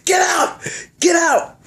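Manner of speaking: high-pitched mock yell; retold shout. Man paying up and ordering her out, retold yell. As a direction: yell it as if throwing someone out.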